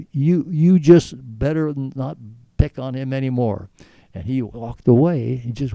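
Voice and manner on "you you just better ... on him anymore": stern warning. Retold or imitated. Warning a bully to back off.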